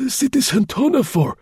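put-on high old man's exclamation; thin old voice calling out in surprise; startled old concierge. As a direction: cry out his name like a surprised old man. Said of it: "he exclaimed".

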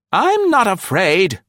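put-on high, loud, slow old woman insisting; defiant elderly voice, high and deliberate; defiant old lady. A narrator's reading. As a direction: refuse to be scared. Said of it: "Nora Beamish insisted defiantly".

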